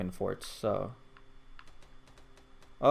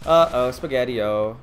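playful catchphrase; 9 dB louder, slower than usual. Pretend. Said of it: "playful catchphrase take".

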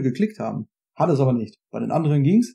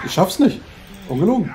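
frustrated; 6 semitones higher, 4 dB louder than usual. Real, not acted. Failing quick-time event.

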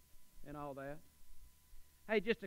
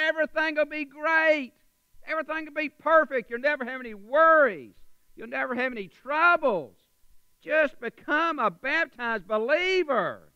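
high, loud hype-preacher pitch; over-the-top promise; 9 semitones higher, 11 dB louder than usual. Acted. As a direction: sell it big, like a too-good-to-be-true offer. Mock promise to baptism candidates.

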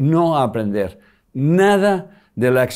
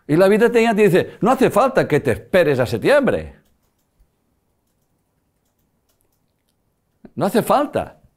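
raised, emphatic retelling; excited emphasis; 6 semitones higher than usual. Retold or imitated. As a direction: let life speak up, insistent. Life voiced telling daughter.

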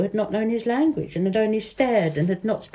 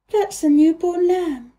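a higher, lightly voiced young girl; girl's voice; 9 semitones higher, 4 dB louder than usual. A narrator's reading. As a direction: read Mary as an eager little girl, pitched up. Mary voiced in a story.

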